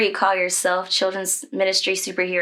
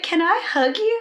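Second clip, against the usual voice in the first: tearful ask, pitched much higher; choked-up, much higher request to the nurse; crying plea; 11 semitones higher than usual. Retold or imitated. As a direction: blurt it out through tears. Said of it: "And I just cried".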